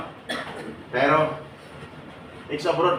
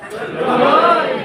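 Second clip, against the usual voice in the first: loud group shout, pitched up; shouted answer; 8 semitones higher, 6 dB louder than usual. Real, not acted. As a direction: yell it out with courage. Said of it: "sumigaw din kayo ng malakas". (malakas na sigaw)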